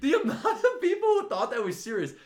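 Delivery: laughing high through the words; can't hold back the giggle. Real, not acted. Laughing while telling a friend about the grill prank.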